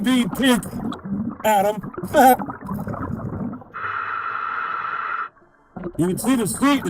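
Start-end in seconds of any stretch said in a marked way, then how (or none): 2.0-5.3 breathy laugh. Real, not acted. Joking that the car is pink.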